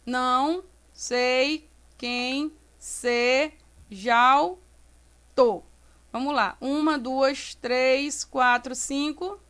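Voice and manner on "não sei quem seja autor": very slow, one syllable at a time; counting syllables. Shown as a demonstration. Teacher counts poetic syllables for students, slowly separated syllables. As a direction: chop "não-sei-quem-se-jau-tor" apart with pauses. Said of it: "Aí eu conto".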